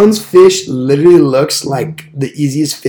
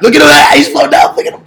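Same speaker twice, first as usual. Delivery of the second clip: high, loud burst of excitement; excited shouting; 8 dB louder than usual. Real, not acted. Host pointing out their fish, excited high shout. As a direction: shout for everyone to look, pitch way up.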